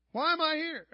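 loud, high exasperated question; exasperated shout. Retold or imitated. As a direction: cry out why, fed up. Asking God, exasperated.

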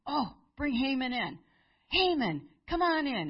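a king eagerly calling his favourite in; royal summons. Acted. King summons Haman to court.

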